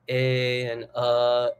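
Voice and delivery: slow, drawn-out "eh and uh" held as sung vowels; middle vowels demo. Shown as a demonstration. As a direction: stretch "eh" and "uh" slowly as a vowel demo. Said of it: "middle kind of vowels like".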